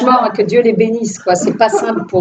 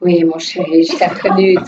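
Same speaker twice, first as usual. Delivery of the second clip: vixen phone voice; 4 semitones lower than usual. Retold or imitated. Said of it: "j'ai pris une voix de garce au téléphone".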